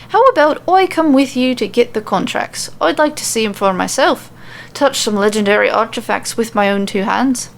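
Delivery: offering to tag along in an Irish lilt; eager Irish brogue, lightly voiced; Irish accent. Becky offers to join the quest, Irish accent. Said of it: "super hard to switch between accents".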